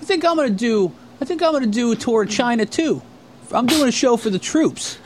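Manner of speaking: high, naive mock announcement; Ray voice. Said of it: "he goes, uh".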